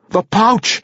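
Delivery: put-on high sharp drunk shout. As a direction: bark for the pouch. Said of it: "Ivor Breen shouted".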